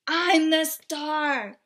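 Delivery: loud slow shriek, a jealous pig. Acted. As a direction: shriek it out in a rage. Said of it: "He shrieked".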